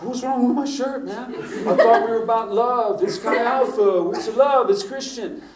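put-on high, whiny young guy's voice; mock protesting kid. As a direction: play the offended kid defending his shirt. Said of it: "He's like".

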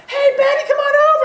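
put-on high, loud party-kid call; partying teen. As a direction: call out like a rowdy teenager.

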